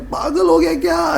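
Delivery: high pitched incredulous cry; mock yell. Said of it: "ऐसा वो चिल्लाता है". (ऊँची आवाज़ में हैरानी से चिल्लाना)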